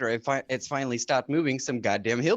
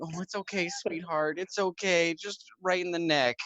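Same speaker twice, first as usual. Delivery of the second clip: higher, softer, comforting phone voice; gently calm a crying caller; soothing operator; 7 semitones higher, 3 dB quieter than usual. Retold or imitated. 911 operator talks a sobbing caller through it, soft and higher. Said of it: "a nine one one operator going".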